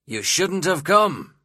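narrated gruff host, loud and drawn out; unfriendly host. A narrator's reading. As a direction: scold an unwelcome guest, slowly and loudly. Gruff host rebukes his guest.

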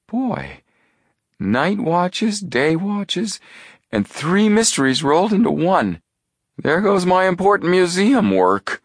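high-pitched, whining complaint; comic high-voiced lament, building in volume; loud groaning. A narrator's reading. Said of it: "He groaned loudly".

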